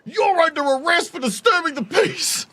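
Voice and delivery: high, slow, loud officious bark; mock cop bark. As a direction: play a pompous cop making an arrest. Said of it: "And they go".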